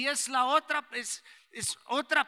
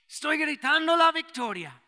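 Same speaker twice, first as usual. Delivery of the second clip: Estoy gritando la victoria, higher and louder like a triumphant shout; the old pastor shouting his answer; shouting the victory; 5 semitones higher, 6 dB louder than usual. Retold or imitated. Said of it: "Y sí puede gritar".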